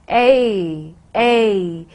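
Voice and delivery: A as a long ay glide; Cockney vowel. Shown as a demonstration. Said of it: "Cockney accent, it becomes".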